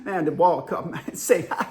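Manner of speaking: garbled, chuckling country dude; comic impression of unintelligible speech; Sammy. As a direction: swallow the words between odd laughing noises. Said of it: "Sammy communicated".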